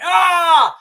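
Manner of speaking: sudden loud yell as he sprints in; charging yell. Retold or imitated. Son charging at his mum with a yell. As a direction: roar it out, charging straight at her. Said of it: "I went".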